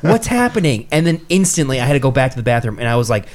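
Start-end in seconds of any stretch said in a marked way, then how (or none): 0.0-0.9 high-pitched comic bewilderment; mock-panicked cry for answers; retold alarm. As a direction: quote your own panic, pitched way up. Retells his alarm at falling sick.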